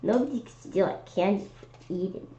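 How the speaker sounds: ordinary delivery, nothing marked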